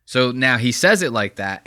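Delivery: normal talking voice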